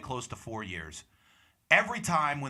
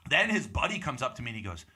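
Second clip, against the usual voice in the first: usual speaking voice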